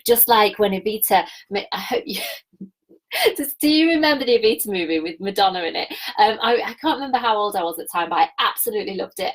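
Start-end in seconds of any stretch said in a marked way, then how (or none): none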